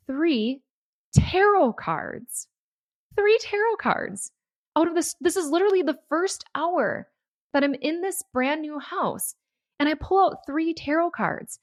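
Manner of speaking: can't believe what she just found; thrilled disbelief. Real, not acted. Finding tarot cards in new stove.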